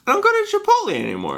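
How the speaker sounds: very high, fast and squeaky. Acted. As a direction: rattle it off in a tiny voice. Same line high and fast.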